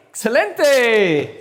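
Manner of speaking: loud, drawn-out Excelente; big teacherly hurrah; delighted praise. Real, not acted. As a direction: cheer the right answer, slow and high. Teacher cheers a correct answer.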